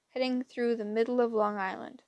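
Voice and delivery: ordinary delivery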